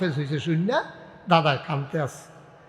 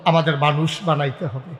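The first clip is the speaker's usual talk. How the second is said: usual speaking voice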